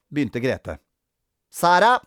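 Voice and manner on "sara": high, loud rising name; officious child teacher; roll call. A narrator's reading. Grete takes the register.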